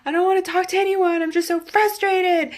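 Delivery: high, fast, frustrated snap; irritated outburst at her mother on the phone; snapping at mom. Retold or imitated. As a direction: brush mom off, fed up and overwhelmed. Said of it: "And I was like".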